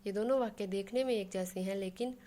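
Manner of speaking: usual speaking voice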